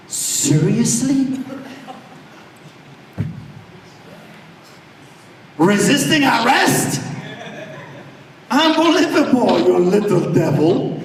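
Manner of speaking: can't believe what he hears; incredulous. Real, not acted. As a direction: pastor scoffing at the officer's charge. Outraged at the arrest charge.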